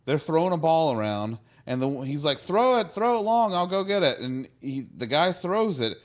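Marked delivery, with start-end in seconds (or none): 2.4-4.2 high, louder play-acted boy at play; eager calling out. Wesley calls to friends throwing a ball, eager. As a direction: call for the ball, pitched right up.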